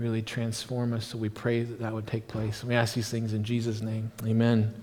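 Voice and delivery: low, soft praying voice; closing prayer. Real, not acted. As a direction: pray it quietly and reverently, close to a murmur. Closes an opening prayer.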